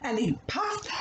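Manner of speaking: usual speaking voice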